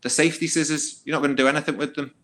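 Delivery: high, fast, scoffing put-down; mocking dismissal. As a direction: laugh off the threat. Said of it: "He's like".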